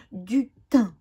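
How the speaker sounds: ordinary delivery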